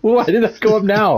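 laughing. Real, not acted. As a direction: ask it while cracking up. Laughing at a mishap in game.

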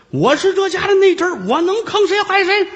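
high, wailing fake sob; hammed-up weeping protest of innocence; fake crying. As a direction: whine it high like crocodile tears. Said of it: "张世芳哭天抹泪儿".

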